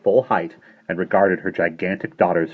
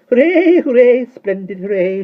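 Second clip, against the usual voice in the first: very high, loud shrill cheering; 12 dB louder than usual. A narrator's reading. Tiny shopkeeper dancing for pure pleasure, shrill cheers. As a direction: cheer it out while dancing a jig. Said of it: "she cried in her shrill little voice".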